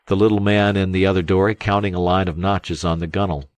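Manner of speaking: ordinary delivery, nothing marked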